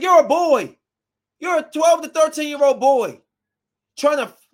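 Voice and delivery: high, heated callout; shouted accusation. Retold or imitated. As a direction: call the kid out loudly. Retold shouted accusation at kid.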